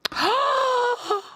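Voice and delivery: sharp, high, excited gasp. Real, not acted. Sudden excitement at the music.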